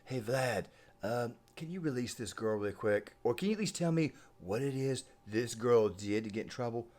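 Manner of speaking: hushed, casual imitation asking a favor; Donald Trump. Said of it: "he may have put in a call and said".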